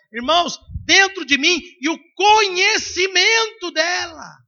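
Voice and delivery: loud, high, fervent; rising shout of conviction; preaching at full force. Acted. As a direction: preach it hard to the church.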